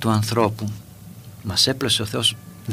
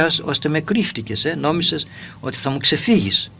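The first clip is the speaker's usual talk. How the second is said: ordinary talk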